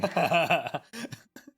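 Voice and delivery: loud bright burst of laughter; hearty laugh. Real, not acted. Laughing at friendly joke.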